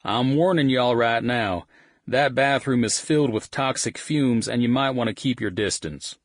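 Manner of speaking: Texan bandmate warning about the bathroom; Texan accent. Acted. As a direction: announce it like a Texan cowboy. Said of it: "he announced in his Texan accent".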